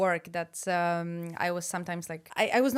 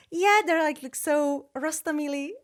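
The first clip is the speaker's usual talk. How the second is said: put-on Czech word said in an English accent, pitched up and louder; bright English-accented praise, a touch higher; 8 semitones higher, 4 dB louder than usual. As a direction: say rostomily like an English speaker, eager. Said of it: "I said it with English accent".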